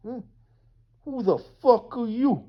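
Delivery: mock wiseguy challenge; Guido voice. Mocking Denmark in a Guido voice, tough wiseguy challenge. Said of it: "why I'm talking like Guido".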